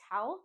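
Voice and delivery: talc as "talk" with a sounded L; wrong L sound. Retold or imitated. Teacher imitating students' mistake, L sounded. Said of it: "I've heard many students say".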